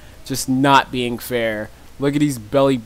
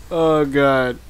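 normal talking voice

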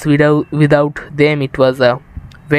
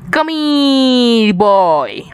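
creepy lure; 5 semitones higher, much slower than usual. Pretend. Luring teammate for a trap.

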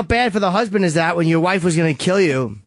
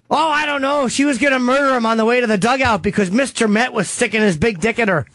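higher-pitched gossip voice; gossiping townsperson; 5 semitones higher than usual. Acted. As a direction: play a chattering town gossip spilling the story. Same gossip voice resumes.

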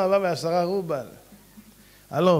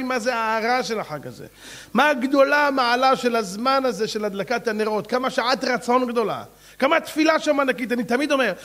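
higher, louder preaching; fervent preaching; 5 semitones higher, 4 dB louder than usual. Real, not acted. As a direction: rouse the crowd. Preaching with fervour.

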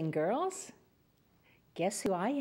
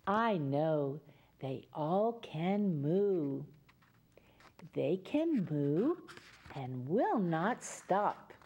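slow storytelling; 4 semitones lower, slower than usual. Acted. Reading story slowly.

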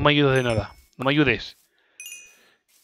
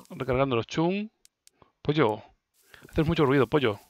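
normal talking voice

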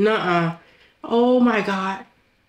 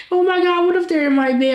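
panicked gasp; 8 semitones higher, faster than usual. Acted. Panicking alone about roaches.

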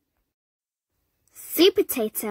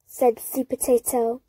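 ordinary delivery

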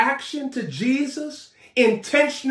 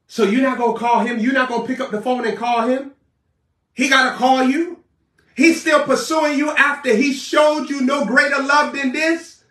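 ordinary delivery

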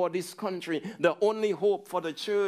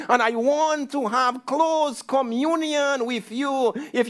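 louder, higher preaching of God's plea; impassioned preaching; 5 semitones higher, 4 dB louder than usual. Acted. Preacher pressing God's love on the church, loud and high. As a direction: lift the voice and plead with the congregation.

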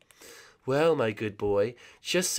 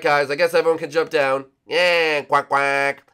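usual speaking voice

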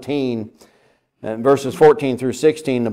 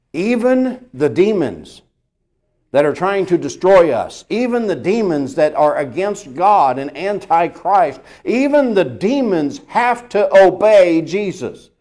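preaching build; 5 semitones higher, 4 dB louder than usual. Acted. Building preaching over repeated phrase.

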